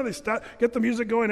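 ordinary delivery

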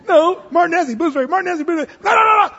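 high, loud "no, no, no"; hammed-up bickering shout; mock marital shouting match. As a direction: yell like a stubborn spouse.

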